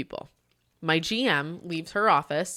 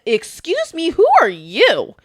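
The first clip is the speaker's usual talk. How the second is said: higher, louder, offended "who are you"; retold boss snapping back, louder; indignant retort; 10 semitones higher, 10 dB louder, slower than usual. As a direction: bristle at him as the boss retold. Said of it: "She flipped and literally looked".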